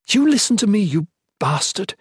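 high, loud burst of desperate command; desperate order. A narrator's reading. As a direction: demand to be heard, desperately. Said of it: "voice desperate".

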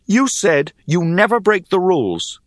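stern, insistent challenge. A narrator's reading. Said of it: "I regarded her sternly".

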